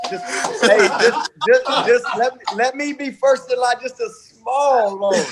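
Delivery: high, laughing, joking beg; laughing mock plea. Real, not acted. Coach teasing his rich former quarterback for a loan, laughing high plea.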